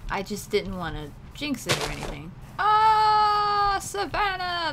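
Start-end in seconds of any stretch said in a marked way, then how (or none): 2.6-4.7 high loud delighted exclaim; delighted cry. Real, not acted. Thrilled at savannah.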